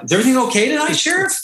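high, polite questioning guard; gate guard. Retold or imitated. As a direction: ask the sheriff carefully.

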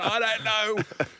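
high, slow, loud helpless whine; Beast's nervous reply. Retold or imitated. Beast shrugging off the emergency, high drawn-out whine. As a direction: drag out the helpless answer.

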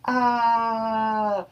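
long, high-pitched mock scream at normal volume; held high "aah", imitating a scream. Rigby screams at the growling wizard, long high mock scream. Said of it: "As Rigby is screaming".

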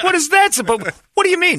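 high-pitched exaggerated outrage; mock indignation. Dog owner answering an insult, mock indignant. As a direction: squeak it in hammed-up disbelief.

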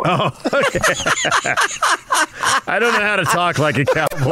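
laughing while protesting; giggling through the words. Real, not acted.

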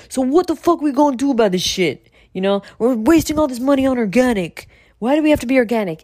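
high-pitched, animated mock New York complaint; cursing investors. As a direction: act out an exasperated investor in a meeting. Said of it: "in these meetings with investors".

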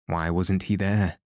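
ordinary delivery

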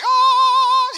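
very high, loud held "ah"; singing rocks. Pretend. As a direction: imitate rocks belting a high note. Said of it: "that's what they sounded like".

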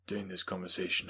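ordinary delivery, nothing marked

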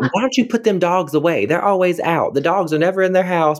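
high-pitched mocking kid voice; teasing students. Students teasing teacher about feet.

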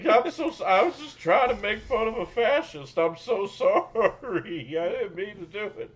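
apologizing through high bursts of laughter; laughing apology. Real, not acted. Laughing apology after offensive joke.

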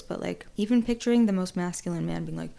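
ordinary talk

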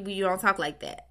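usual speaking voice

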